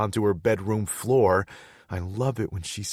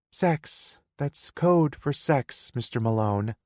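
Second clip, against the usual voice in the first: put-on higher pitched playful girlfriend; young woman voice; 8 semitones higher, 3 dB louder than usual. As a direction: voice her higher, coaxing. Girlfriend teasing.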